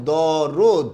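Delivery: দরদ slowly as daw-rod; model pronunciation. Shown as a demonstration. Teacher giving the pronunciation, slow model syllables. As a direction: stretch দরদ, open first vowel, closed o second. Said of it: "এটার উচ্চারণটা হবে".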